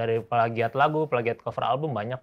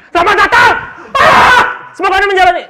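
much higher and louder, yelling the welcome; shouted pilot welcome; 16 dB louder than usual. Shown as a demonstration. Pilot showing how bad intonation sounds, shouting. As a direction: blast the greeting like a panicked pilot. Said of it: "coba kalau gini".

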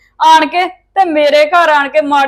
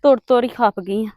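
low, hushed complaint to oneself; muttered grumbling; 7 semitones lower, 4 dB quieter than usual. Acted. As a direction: grumble under your breath, softly and low. Grumbling alone while trudging along.

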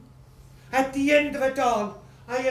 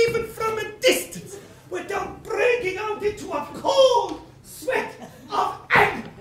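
ranting fury; 8 semitones higher than usual. Acted. Creditor raging about the widow, higher ranting fury. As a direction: rage at the very thought of her.

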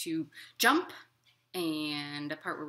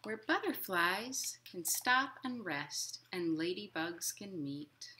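gentle, lilting picture-book reading; reading to children; 3 semitones higher than usual. A narrator's reading. Librarian reading a picture book to children, lilting. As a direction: read it like storytime for little kids.

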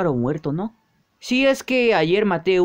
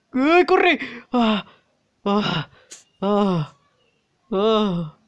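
panicked run; 3 semitones higher, much slower than usual. Acted. Character flees the train in panic. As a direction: shout it fleeing. Fleeing again.

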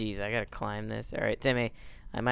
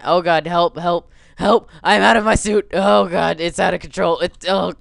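loud higher mock panic cries; 5 semitones higher, 9 dB louder than usual. Gamer crying for help as his suit falls. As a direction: yelp for help. Iron Man falling panic.

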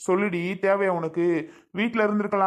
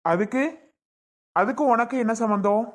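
louder and higher, pressing her for an answer; raised demanding voice; 5 semitones higher, 4 dB louder than usual. Acted. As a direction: insist loudly, what has it to do with you. Husband demands why she's blamed. (சுந்தரியிடம் கணவன் கோபமாகக் கேட்கிறான், உரத்த குரலில்)

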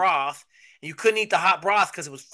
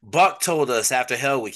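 ordinary talk

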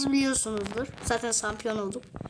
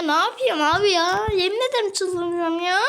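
higher, louder exasperated complaint; frustrated outburst; 5 semitones higher, 4 dB louder than usual. Real, not acted. Gamer stuck on the level, frustrated outburst. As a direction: vent the frustration.

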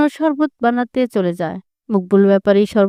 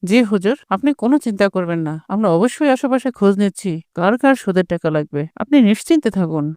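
servant's reply; 4 semitones lower than usual. Acted. Servant voiced by narrator actor.

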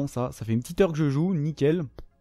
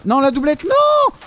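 high, loud anguished no; despairing shout; 7 semitones higher, 4 dB louder than usual. Real, not acted. Gamer loses his prized gun, high anguished shout. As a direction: wail as the prize slips away. Losing his weapon mid-game.

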